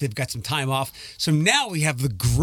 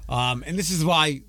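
ordinary talk

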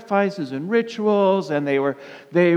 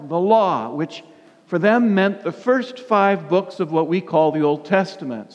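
ordinary delivery

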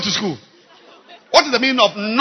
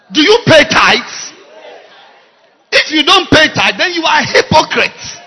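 loud, higher, accusing pulpit voice; preacher's challenge; 4 semitones higher, 6 dB louder than usual. Real, not acted. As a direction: confront the congregation at full force. Preacher challenging congregation on tithes.

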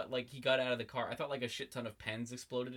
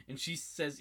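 ordinary talk, nothing marked

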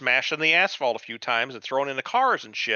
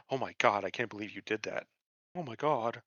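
pearl-clutching Superman; 3 semitones lower than usual. Retold or imitated. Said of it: "Superman's over here, clutching his pearls".

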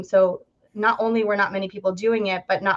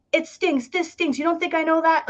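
raised pitch, mild mock yell; higher-pitched, restrained retold outburst; quoted movie yelling; 7 semitones higher than usual. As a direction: imitate an upset yell without much volume. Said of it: "he's yelling".

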